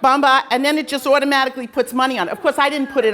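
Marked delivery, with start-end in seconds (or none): none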